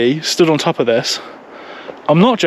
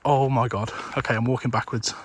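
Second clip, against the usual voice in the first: much softer, almost whispered, urgent; hushed and tense; 4 semitones lower, 12 dB quieter than usual. Real, not acted. Backing away from an angry capercaillie: tense, much softer hushed voice. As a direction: keep it barely audible so the bird stays calm.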